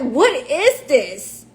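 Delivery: retold bewildered demand, stretched out; exasperated disbelief. Wife questioning officials about her husband's treatment, exasperated disbelief.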